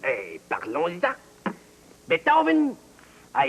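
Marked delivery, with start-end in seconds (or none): 2.1-2.8 loud emphatic. Acted. Quiz host stresses the composer.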